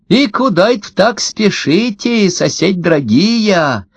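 high, drawn-out honeyed singsong; sugary, lilting, high-pitched greeting; singsong cooing. A narrator's reading. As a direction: coo it slowly to the dear neighbours. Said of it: "распевала, ворковала Ксения".

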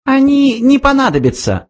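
very high little mouse voice; interrupting mouse. A narrator's reading. Mouse interrupts the old man, high mouse voice. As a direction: cut in, pitched right up. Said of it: "вмешался Фрэнки".

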